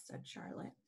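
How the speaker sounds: usual speaking voice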